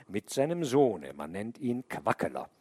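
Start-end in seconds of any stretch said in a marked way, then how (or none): none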